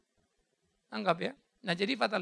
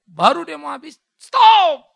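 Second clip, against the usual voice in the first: dramatic stop; 6 semitones higher, 18 dB louder, slower than usual. Retold or imitated. Dramatic halt of Isaac's sacrifice.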